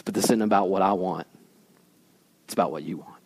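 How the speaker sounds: very soft, low, hushed submission; surrendered prayer. Acted. Jesus praying to God in the garden, hushed and surrendered. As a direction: let it fall away into a whisper of surrender.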